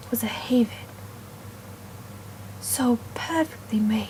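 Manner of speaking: hushed, admiring whisper. Acted. Teller whispering in awe about the shelter. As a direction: marvel at it softly.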